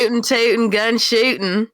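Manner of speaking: slow Southern drawl on request; Southern accent. Shown as a demonstration. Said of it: "please say that".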